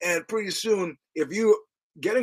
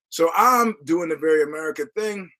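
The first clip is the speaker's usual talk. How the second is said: ordinary talk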